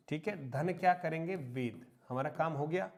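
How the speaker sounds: usual speaking voice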